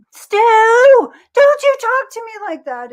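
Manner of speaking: high, loud, slow scolding; imitating Helen scolding. Wife scolding husband, high loud slow scold. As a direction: scold him from downstairs, drawn out. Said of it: "And then, like".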